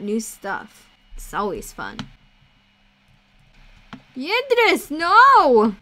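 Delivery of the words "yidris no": loud, high cry of protest; high-pitched, drawn-out holler; dismayed shout. Pretend. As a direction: yell after a wayward companion. Calling out as companion goes wrong.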